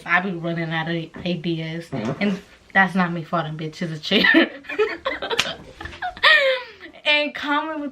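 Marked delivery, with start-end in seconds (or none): 4.5-5.5 high-pitched giggle; laugh. Real, not acted. Laughing about the chair noise that sounded like farting, amused.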